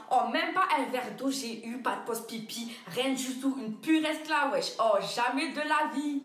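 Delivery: usual speaking voice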